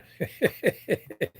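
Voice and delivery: high wheezy chuckle; real run of high chuckles; helpless high giggle; laughing. Real, not acted. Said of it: "I'm laughing and shaking the phone".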